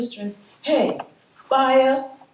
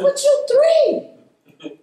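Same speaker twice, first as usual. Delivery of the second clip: amused disbelief; 6 dB louder, much slower than usual. Retold or imitated. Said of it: "I said".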